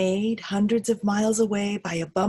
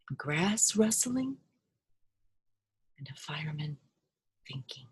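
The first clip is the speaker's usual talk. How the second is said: whisper; 4 semitones lower, 7 dB quieter than usual. A narrator's reading. Quiet noises, fading to nothing.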